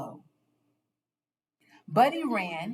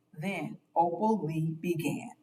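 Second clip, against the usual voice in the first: ordinary delivery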